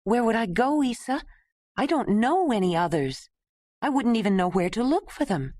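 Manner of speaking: put-on lost girl asking her dying mother; frightened girl. Ayla lost at dying mother's words.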